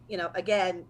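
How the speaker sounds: usual speaking voice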